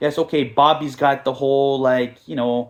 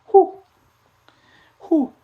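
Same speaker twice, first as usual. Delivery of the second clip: sharp high excited whew; 7 dB louder than usual. Real, not acted. Hearing the Henry rumour, high excited whew. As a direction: blow out in excitement. Reacting to big news.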